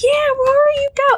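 high cheering praise. Retold or imitated. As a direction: sing it out like a proud parent. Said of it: "we're like".

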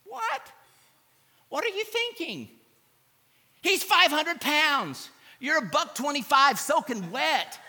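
high, disbelieving, half-mocking; incredulous audience. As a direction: ask like he's gone mad. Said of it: "We're thinking".

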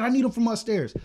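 imitating a high, loud retort; arguing coworker. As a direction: answer back high and defensive. Coworker answering in acted quarrel.

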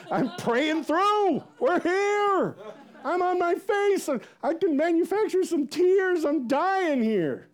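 very high, loud hammed-up wail; mock desperate plea. Pastor on his face before God, mock desperate wailing. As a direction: plead melodramatically, dragging out the ends.

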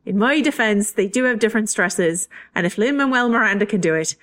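amused, clumsy Irish brogue; playful put-on Irish accent; garbage Irish accent. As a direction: read the postscript in a bad Irish accent. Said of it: "my garbage Irish accent".

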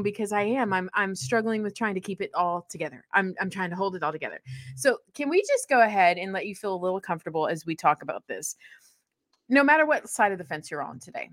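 normal talking voice

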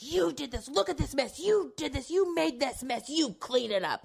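high-pitched imitation of an angry kid; scolding, high mock-kid voice; acted child's accusation. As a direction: mimic an indignant child pointing blame. Oldest child accusing his mother, acted.

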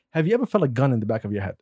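usual speaking voice

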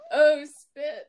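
high, loud, spunky "Oh, spit"; a perky little old woman's mild curse; cute little old lady. Retold or imitated. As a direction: play the scrappy little spitfire lady. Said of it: "That was so cute".

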